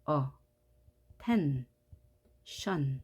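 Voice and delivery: "attention" split as 어, 텐, 션 with long pauses; three isolated beats, 어 / 텐 / 션; syllable by syllable. Shown as a demonstration. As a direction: sound out each syllable of "attention" separately. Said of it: "각각의 음절이 만들어지는 겁니다".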